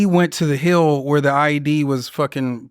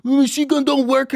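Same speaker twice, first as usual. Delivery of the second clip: much higher, put-on Iraqi accent; Iraqi soldier's broken English; 9 semitones higher than usual. Recruit excusing himself to the Marine in an Iraqi accent. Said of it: "He's like".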